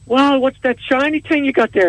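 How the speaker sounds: high, wide-eyed amazement; amazed mate Sean. Acted. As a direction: gawk at the shiny thing. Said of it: "Sean comes up and he goes".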